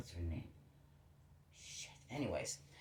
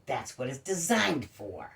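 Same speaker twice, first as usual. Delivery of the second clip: slow, deadpan That's what it's designed for; dry slow retort; slower than usual. Retold or imitated. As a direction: drag it out, dry and sarcastic. Retold dry retort to the cop.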